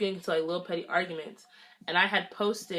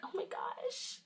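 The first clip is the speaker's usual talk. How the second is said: much higher, breathy giggly gush; mock gushing; 9 dB quieter than usual. Narrator imitating herself forgiving the ex, mock swooning. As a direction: play your naive past self melting at his words. Herself falling for his excuse.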